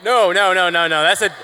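a big, loud real protest to the crowd. Real, not acted. Panelist shouting down the tarantula-owner idea.

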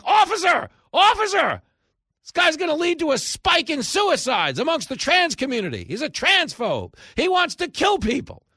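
very high, loud calls for an officer; hammed-up shrill outcry; hysterical accuser. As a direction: shriek the accusation for the police. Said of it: "And they're like".

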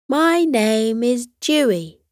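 little dinosaur voice, cheerful. A narrator's reading. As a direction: introduce yourself happily. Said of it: "he said cheerfully".

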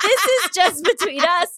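laughing through the words. Real, not acted. Laughing show title.

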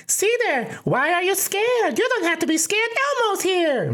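very high falsetto puppet voice; sky-high cartoon monster impression; Elmo. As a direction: squeak it out like a children's puppet, reassuring a kid. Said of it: "I use my Elmo voice".

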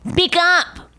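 imitating his rude command; mock rude order. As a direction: bark it like a jerk. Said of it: "You said".

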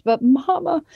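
high mock sob of a child pleading; imitating a sob. Mother imitating her sobbing daughter's plea, high mock sob. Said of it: "And she just sobbed".

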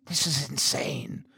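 soft, breathy whisper of disbelief. A narrator's reading. Priest doubts the man's story, whispering. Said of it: "I whispered".

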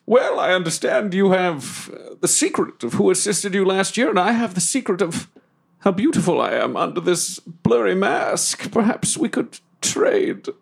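hammed-up flirty bargaining; theatrical suitor, coy. Plays Deathwolf bargaining flirtatiously.

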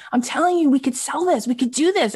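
high, urgent enthusiasm; acted-out eager salesman; insistent pitch. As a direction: press the idea on a reluctant partner. Acting out Brian's insistent pitch.

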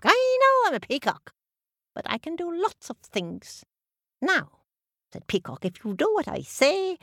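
high, bossy peacock. Acted. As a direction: take charge proudly.

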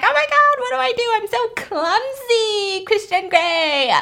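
very high, drawn-out mock wail; mock damsel whine, slowing as it goes; hammed-up dismay. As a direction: squeal a helpless apology, stretching the last words. Acted clumsy woman apologising, hammed up.

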